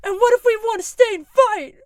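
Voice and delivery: loud, high defiant shout; Ernie Macmillan. Acted. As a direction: shout it out to challenge the room. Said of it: "Ernie Macmillan has something to say".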